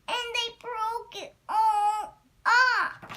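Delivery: put-on high, loud, slow Baby Bear wail; wee small voice. A narrator's reading. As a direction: draw out the little bear's outcry. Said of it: "cried Baby Bear in his wee small voice".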